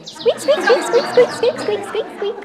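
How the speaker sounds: very high, rising squeaks like a squeezed toy; squeaky dog toy. Retold or imitated. Mimicking the goldfinch call for the birders, high toy squeaks. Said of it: "I call it our squeaky dog toy".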